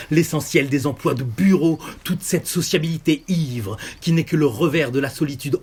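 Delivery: a list that mounts item by item in pitch and force; building tirade. Acted. Virus lists humans' needless institutions, in a building tirade.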